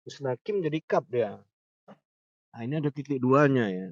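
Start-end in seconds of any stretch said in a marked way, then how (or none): none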